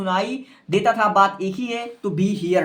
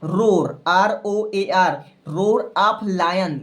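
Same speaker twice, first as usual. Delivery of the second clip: slow dictation; much slower than usual. Shown as a demonstration. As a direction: roar spelt and said very slowly. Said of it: "तो लिखोगे the". (धीरे-धीरे बोलकर लिखवाना)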